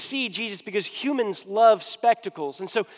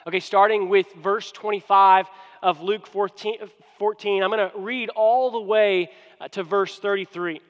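ordinary talk, nothing marked

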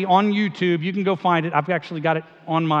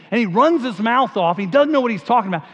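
put-on higher, louder aw-shucks Southern accent; folksy Southern accent, pitched up and loud; aw shucks Southern Peyton Manning; 5 semitones higher, 5 dB louder than usual. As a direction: play the humble Southern quarterback in a Southern accent. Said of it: "sort of talks like this".

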